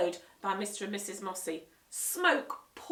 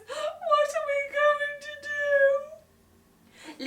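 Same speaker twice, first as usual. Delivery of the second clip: much higher, very slow sobbing plea; crying; 8 dB louder, much slower than usual. Acted. Mum crying over ruined party, sobbing. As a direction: sob it out. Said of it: "burst into tears".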